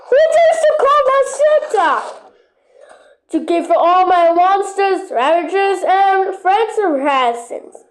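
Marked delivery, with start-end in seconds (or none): 0.1-1.9 higher, faster challenge. Pretend.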